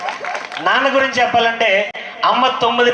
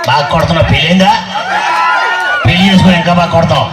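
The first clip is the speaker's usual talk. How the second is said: mimicry voice; 3 dB louder than usual. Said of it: "రకరకాల వాయిస్లు mimicry చేస్తూ ఉంటాం మేము. For example".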